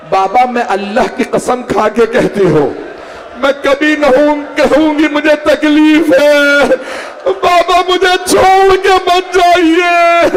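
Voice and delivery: very high, loud, slow keening; wailing plea. A narrator's reading. Sick daughter begs her father not to leave, wailing.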